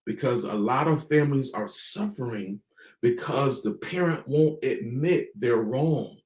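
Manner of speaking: ordinary delivery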